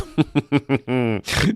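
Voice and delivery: a smug put-on cackle at his own trolling; mock gleeful laugh. Host laughing to bait conservative viewers, smug cackle. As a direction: laugh like he's poking the bear. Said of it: "See what I'm doing there?".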